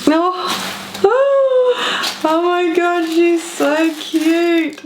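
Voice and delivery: high-pitched gushing, slowing on the last words; delighted squeal. Real, not acted. She sees Sandy's cute wrapping, a high delighted squeal. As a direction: coo over something adorable.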